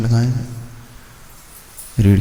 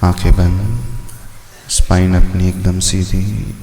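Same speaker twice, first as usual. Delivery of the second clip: lower, measured instructions with pauses between phrases; guided meditation; 4 semitones lower than usual. Real, not acted. Teacher guiding students with eyes closed, meditation voice. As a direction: settle them into stillness, phrase by phrase.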